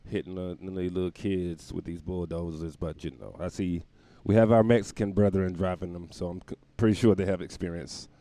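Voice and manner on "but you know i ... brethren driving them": normal talking voice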